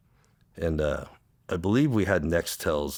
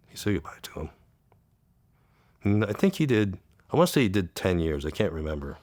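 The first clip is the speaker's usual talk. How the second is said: ordinary talk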